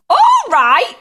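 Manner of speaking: high, loud incredulous echo; indignant yell. A narrator's reading. Grandma to reassuring George, outraged yell. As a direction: throw the words back loudly. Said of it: "she yelled".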